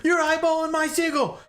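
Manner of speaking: high, strained drill instructor yell; mock screaming. Instructor catching recruit watching seagull, mock scream. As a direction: imitate an outraged instructor screaming. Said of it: "And he's screaming at him".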